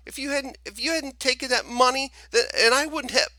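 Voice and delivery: put-on boy crying out blame; high stammering accusation. Boy blaming storekeeper, stammering.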